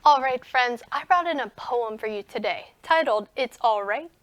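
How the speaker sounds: ordinary talk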